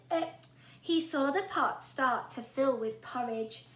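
usual speaking voice

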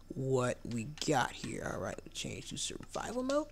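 slow whispered aside; hushed whisper. Real, not acted. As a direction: whisper it to yourself. Quietly switching game mode.

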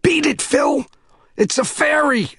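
high panicked shout. A narrator's reading. Earl running off, shouting a warning to Phil. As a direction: yell it running away. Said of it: "he shouted".

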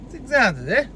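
mock New York accent. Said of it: "Get some goddamn New York accent".

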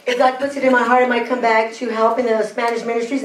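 ordinary talk

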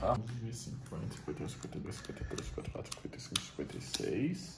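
low murmured counting; counting under breath. Real, not acted. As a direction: mutter the numbers to yourself.

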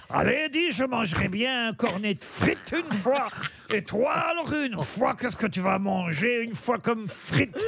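hammed-up Belgian with a shouted une fois; Belgian accent. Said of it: "C'est un Belge et un Français". (accent belge forcé, une fois appuyé)

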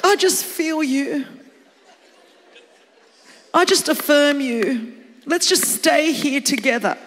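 syrupy parody of validation; mock sympathy. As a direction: commiserate with exaggerated concern. Continues the mock sympathy act.

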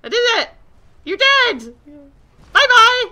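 high, very loud gleeful crowing; triumphant shouting. Real, not acted. Gamer believing the dragon is dead, triumphant shouting. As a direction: crow over a beaten foe at full voice.

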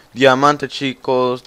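loud burst of joy over diamonds; excited shout. Real, not acted. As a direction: shout it out, thrilled. Anuncia diamantes a gritos. (gritando emocionado)

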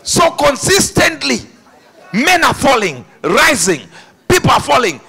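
loud, high-pitched full-force preaching. Real, not acted. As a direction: hammer each phrase out to the congregation.

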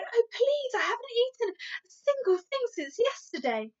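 put-on a hungry child pleading; pleading little girl. Sophie's voice in story reading.

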